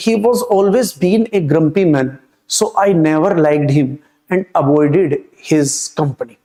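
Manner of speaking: whole sentence drawn out very slowly, word by word; slow read-aloud. Shown as a demonstration. Teacher reading the screen question to students, very slow dictation.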